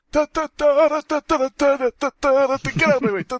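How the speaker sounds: put-on high, very slow, cranky old man; grumpy old man. Old man snapping at a nurse, slow and high. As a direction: shove past the nurse as a cranky grandpa.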